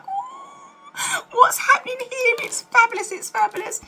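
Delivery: high squealing delight, rising to a shout; excited gushing. Retold or imitated. Friends gushing to her about the date, squealing. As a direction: squeal with glee over the friend's new man.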